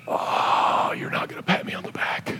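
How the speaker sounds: whispered, crestfallen realization; hushed inner disappointment; deflated whisper. Retold or imitated. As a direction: breathe it out, let down. Retold inner deflated thought.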